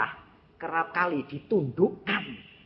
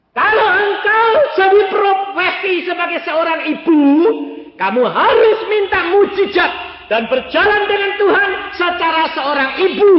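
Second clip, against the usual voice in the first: high, loud, sustained exhortation; full-force preaching; 15 dB louder than usual. Real, not acted. Preacher charging mothers to seek miracles, impassioned shout. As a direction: thunder it out to the congregation.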